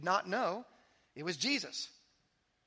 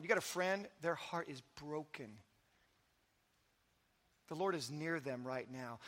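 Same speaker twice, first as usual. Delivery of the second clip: tender reassurance; 4 semitones lower, 6 dB quieter than usual. Real, not acted. Comforting the broken-hearted.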